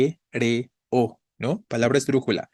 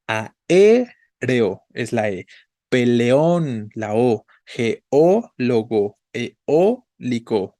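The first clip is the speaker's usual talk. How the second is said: "Aéreo", "peleón", "geólogo", "eólico" drawn out slowly, tonic vowel louder; stressed vowel slowed; 3 dB louder, much slower than usual. Shown as a demonstration. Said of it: "vean cómo estamos tildando siempre la vocal tónica". (palabras lentas, vocal tónica más fuerte)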